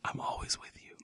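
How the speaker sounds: breathy teasing whisper. Acted. Co-host whispering creepy devotion, whispered. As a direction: whisper it clingily in his ear.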